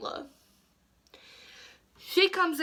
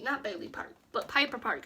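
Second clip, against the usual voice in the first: ordinary talk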